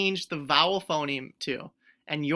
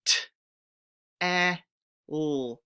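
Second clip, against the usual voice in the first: sounded out phoneme by phoneme; stretched sounds; 3 semitones lower than usual. Shown as a demonstration. Teacher stretching the word with the class. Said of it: "So let's stretch it".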